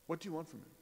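ordinary delivery

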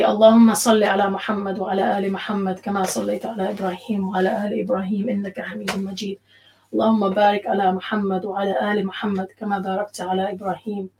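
rapid, even recited salawat; Arabic prayer recitation. Real, not acted. Teacher leading sisters in a closing blessing, quick recited prayer.

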